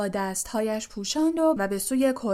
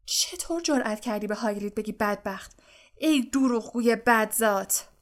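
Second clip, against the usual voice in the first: angry rebuke. A narrator's reading. Angry Hermione scolds Malfoy for calling Hagrid pitiful. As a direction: confront Malfoy for mocking Hagrid. Hermione furious after slap.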